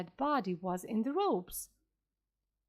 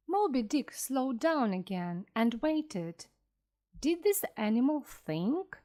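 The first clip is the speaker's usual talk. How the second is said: plain reading voice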